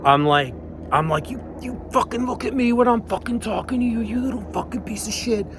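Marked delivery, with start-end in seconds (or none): 1.3-5.4 very high, fast mock tirade; retold screaming. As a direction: squeeze a furious scream into a small, high voice. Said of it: "So I'm still screaming".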